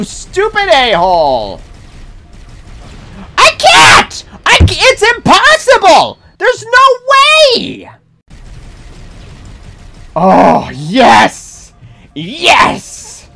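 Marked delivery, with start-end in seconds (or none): 3.4-7.9 high frustrated yelling; raging gamer. Real, not acted. Gamer raging at impossible section. 10.2-13.3 excited. Real, not acted. Sudden success in game.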